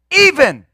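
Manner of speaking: "even" stressed loud with a strong fall; emphatic EVEN. Shown as a demonstration. Preacher repeating the verse's key word, emphatic "even".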